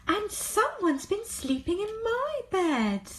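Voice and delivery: Mummy Bear upset about her bed; middle-sized voice. A narrator's reading. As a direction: cry it out in the middle voice. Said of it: "Mummy Bear cried in her middle-sized voice".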